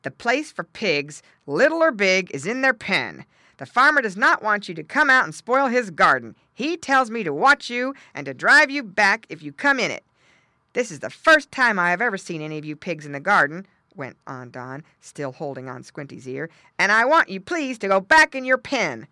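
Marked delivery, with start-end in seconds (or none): 0.0-13.7 loud, emphatic dog voice; stern guard dog. Acted. Guard dog figure lectures pigs. 16.8-19.1 loud, raised commanding voice; stern guard dog. A narrator's reading. Guard dog orders pigs back.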